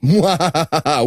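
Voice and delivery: hammed-up "mwa-ha-ha"; stagey scheming laugh; mock villain laugh. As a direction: fake evil chuckle in a steady run. Acted conspirators' fake villain laugh.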